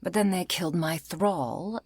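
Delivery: ordinary delivery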